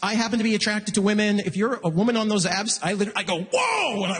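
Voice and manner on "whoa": long high-pitched whoa; 1 mock awe. Comic gawking at dating app photos, a long high whoa. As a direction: gawk like you've never seen a woman.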